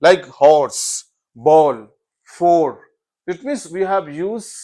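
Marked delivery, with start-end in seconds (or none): 0.4-2.8 "horse, ball, four" loud with a long "aw"; teacher's list, "horse, ball, four" each held long; long vowel examples. Shown as a demonstration. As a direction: punch out each word, stretching the vowel. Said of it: "that is something long is there".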